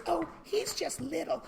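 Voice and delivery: high, soft, dismissive owner voice; doting dog owner. Retold or imitated. Teacher imitating a little dog owner, high and soft. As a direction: shrug it off sweetly. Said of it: "the person says".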